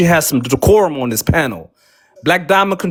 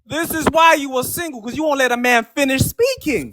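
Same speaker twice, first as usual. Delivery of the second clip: raised voice; 6 semitones higher, 4 dB quieter than usual. Real, not acted. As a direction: pitch jumps up, talking over her. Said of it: "repeats louder higher".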